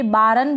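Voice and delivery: बारन as baa-run, two syllables; over-pronounce the R in बारन; बारन with a vowel after a full R; wrong full R. Shown as a demonstration. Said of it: "बोलेंगे तो गलत हो जाएगा".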